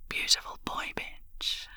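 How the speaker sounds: breathy hushed whisper, slow and intimate. A narrator's reading. Dominant woman whispering to her lover, slow and hushed. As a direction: whisper it close to his ear. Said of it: "I whisper to you".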